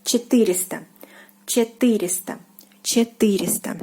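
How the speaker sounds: четыреста with the stress on ы, "che-TY-re-sta"; четыреста, hit the ы. Shown as a demonstration. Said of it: "So the stress falls on ы".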